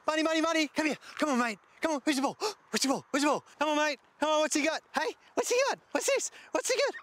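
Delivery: sing-song high voice coaxing a dog; high-pitched dog calling. Real, not acted. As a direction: call the dog in a squeaky excited voice.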